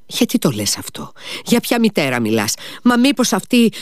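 builds to a sudden high squeak on the last question; confused old man, growing astonished; rising disbelief. Acted. (μπερδεμένη έκπληξη που ανεβαίνει)

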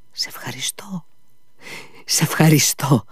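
first thanks breathed unvoiced, then drawn out; drawn out sigh. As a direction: sigh it out as if coming round from a faint. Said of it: "πρόφερε σύρτα, σαν να αναστέναξε".

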